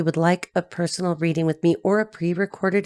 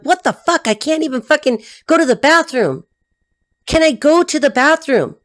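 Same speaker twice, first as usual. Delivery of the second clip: high-pitched, fed-up protest; mock-outraged pleading for some privacy; exasperated complaint; 7 semitones higher, 3 dB louder than usual. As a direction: act out being smothered and fed up. Acts out exasperation at being followed.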